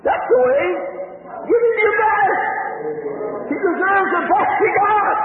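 full-force revival preacher; fiery preaching. Acted. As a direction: drive it home to the congregation. Preaching climax.

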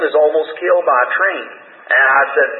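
ordinary delivery

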